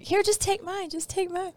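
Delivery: retold snappy offer. Wife to husband when coffee ran out, mock-irritated and high. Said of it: "the way she said it, she was yelling at me".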